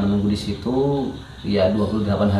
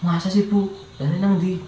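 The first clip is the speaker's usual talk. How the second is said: confused patient; 8 semitones higher than usual. Retold or imitated. Confused patient asks caretaker.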